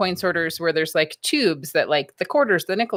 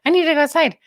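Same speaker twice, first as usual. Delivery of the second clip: dog's voice; 9 semitones higher, 4 dB louder, much faster than usual. Retold or imitated. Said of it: "she'll be like".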